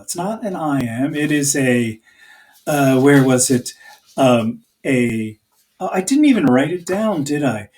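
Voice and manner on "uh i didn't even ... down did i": ordinary talk, nothing marked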